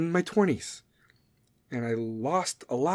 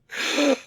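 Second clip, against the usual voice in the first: mock gasp; 11 semitones higher than usual.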